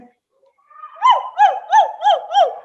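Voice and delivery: high loud mock barking; dog barking in the story, high; high playful woofs. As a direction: woof like a little dog.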